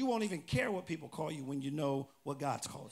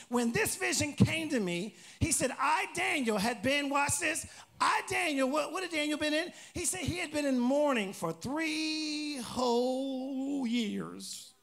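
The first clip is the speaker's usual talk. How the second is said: loud, high preacher's proclamation; emphatic pulpit reading with drawn-out ending; scripture reading; 6 semitones higher, 4 dB louder, slower than usual. Acted. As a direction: call out the verse, stretching key words long. Reads scripture with asides.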